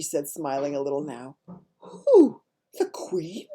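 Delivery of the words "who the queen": shocked cry dropping to a whisper; aghast. A narrator's reading. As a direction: gasp out the shock, then breathe it. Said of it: "Sophie cried aghast".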